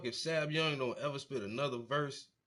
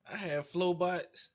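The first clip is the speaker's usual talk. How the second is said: ordinary delivery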